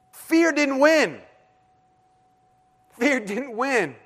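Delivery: high, slow, drawn-out declaration, easing off on the repeat; triumphant realization. Retold or imitated. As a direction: let each word ring as a victory.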